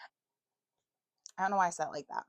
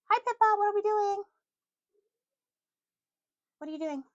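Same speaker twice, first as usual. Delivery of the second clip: high, louder cooing at the cat; pet voice; 13 semitones higher, 6 dB louder than usual. Real, not acted. Owner cooing loudly at her cat Pippa. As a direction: coo at the pet.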